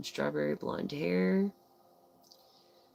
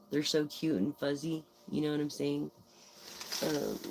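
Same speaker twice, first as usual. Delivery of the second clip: usual speaking voice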